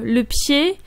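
ordinary talk, nothing marked

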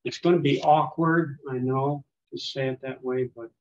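normal talking voice

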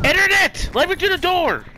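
high, loud rage yell; hyped gamer bro. Real, not acted. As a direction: scream at the internet. Screaming at lag.

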